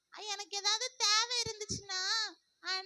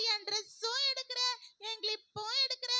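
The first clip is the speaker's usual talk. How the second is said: ordinary delivery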